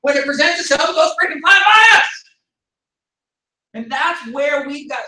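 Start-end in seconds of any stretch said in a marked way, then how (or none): 0.0-2.1 loud, fast, frustrated yelling. Real, not acted. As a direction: raise your voice in exasperation. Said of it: "sorry for yelling, I got a little loud".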